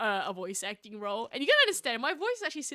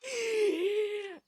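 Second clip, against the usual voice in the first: high-pitched laughing squeal; real shriek of laughter; helpless laugh; 8 semitones higher than usual. Real, not acted. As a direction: burst out in a high cackle. Cracks up over lost voice.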